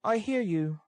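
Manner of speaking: put-on reply high as the put-upon girl; lifted female character voice; forced lightness. A narrator's reading. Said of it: "forcing a laugh".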